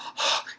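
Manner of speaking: imitated panting on waking; short, breathy gasps, acted out; terrified waking gasp. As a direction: gasp as if jolted out of a nightmare. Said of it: "like, terrified".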